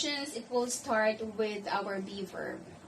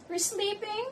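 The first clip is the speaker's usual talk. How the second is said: high-pitched question rising on "sleeping"; rising question intonation; 11 semitones higher than usual. Shown as a demonstration. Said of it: "the intonation will rise at the end".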